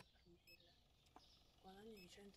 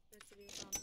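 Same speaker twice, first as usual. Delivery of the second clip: loud angry outburst at a customer; loud exasperated curse; angry insult; 9 dB louder than usual. Real, not acted. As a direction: spit it out, louder. Cursing a customer in game.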